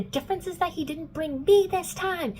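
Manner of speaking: high-pitched retold yelling; mock angry yell. Alec confronting Clary about Jace, mock angry yell. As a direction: imitate an angry, pissed-off outburst. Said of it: "he's, like, yelling. He's, like, really pissed".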